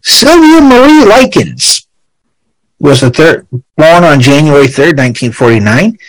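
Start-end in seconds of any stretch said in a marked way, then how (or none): 0.0-1.9 ringmaster. Acted. Announcing the name grandly.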